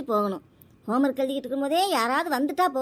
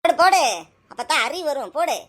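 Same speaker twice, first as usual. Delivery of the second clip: much louder, insistent command; angry order; 6 dB louder than usual. Acted. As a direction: order the child sharply and loudly. Angry mother ordering child to obey. (கோபமாக உரத்து அதட்டும் குரல்)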